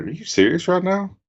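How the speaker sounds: can't believe how good it looks; a lifted incredulous ask; amazed disbelief. Retold or imitated. Reacting to the trailer.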